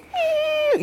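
very high thin squeal; imitated young bull squeal. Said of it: "I can hear little bulls".

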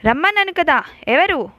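calls out into the dark to scare them; threatening shout. Acted. Sheshayya shouts at an unseen stalker in the bungalow, threatening.

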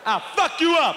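high, loud threat; mock threat. Acted threat.

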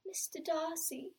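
mock-romantic film quote; romantic heroine. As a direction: swoon softly over the name. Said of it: "she's like".

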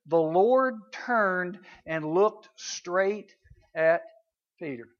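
very slow, word-by-word reading; dramatic slow reading. Shown as a demonstration. Preacher reading the verse to a listening congregation, dramatic slow reading. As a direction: draw out every word for weight.

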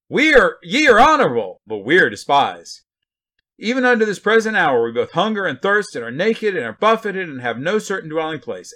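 loud, high, exaggerated mock lament; sarcastic. Preacher reading Paul's list of apostles' sufferings, sarcastically. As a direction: declaim it with biting sarcasm, swooping up high. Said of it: "I'm gonna read it with that tone".

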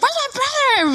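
very high, frantic searching cry; retold urgent call. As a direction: call around the venue for help. Re-enacts frantic search for brother.

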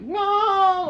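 very high, loud bleating no; goat-like cry of no. Retold or imitated. From the bathroom stall, a very high loud bleating no. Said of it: "goat noises, like".

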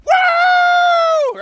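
very high, loud roller-coaster whoop; long high excited woo; teen woo. Retold or imitated. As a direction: whoop like kids on a ride. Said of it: "This is exactly how it went".

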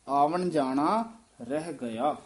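ordinary talk

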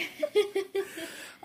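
high giggle; giggling laugh. Real, not acted. Laughing after greeting.

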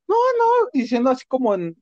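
high, whimpering no, no, imitating someone about to cry; mock tearful pleading. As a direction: mimic a scared guy begging not to be hit. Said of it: "y ya queriendo llorar".

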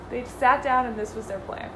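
ordinary talk, nothing marked

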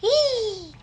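loud, high gleeful cheer; excited yell. Real, not acted. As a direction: blurt it out with glee. Kid cheers about his podcast.

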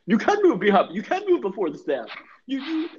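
high, goofy coach caricature; hammed-up dopey instruction; Freddie Kitchens. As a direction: coach them in a silly, addled high voice. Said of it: "I think Kitchens is lying to them".

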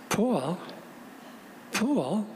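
"Paul" drawn out Long Island style, like "Pawl"; imitating a Long Islander saying "Paul"; Long Island accent. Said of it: "That's how you say Paul on Long Island".

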